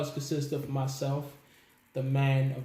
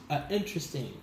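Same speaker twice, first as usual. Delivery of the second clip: normal talking voice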